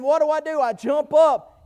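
normal talking voice

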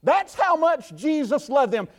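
ordinary talk, nothing marked

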